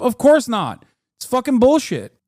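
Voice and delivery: fed-up rant at grind culture advice; exasperated outburst. Real, not acted. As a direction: blast it like it's obvious nonsense. Heated rant against hustle lie.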